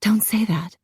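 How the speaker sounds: hushed, shaken whisper; breathless whisper. A narrator's reading. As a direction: barely get the words out. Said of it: "She gasped".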